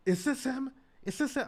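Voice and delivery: high, loud demanding; angry boyfriend. Retold or imitated. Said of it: "And he was like".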